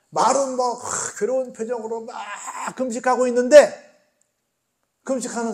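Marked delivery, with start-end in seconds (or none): none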